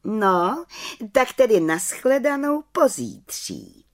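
high-pitched little witch voice; little witch's farewell. A narrator's reading. Little witch takes leave until the council, in a high voice.

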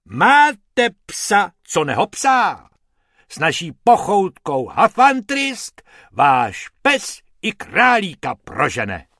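high, loud TV-advert salesman voice; puffed-up ad pitch. Acted. Hubert performs his dog-food ad, loud and puffed up. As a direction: sell it like a commercial. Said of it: "nafoukl a spustil".

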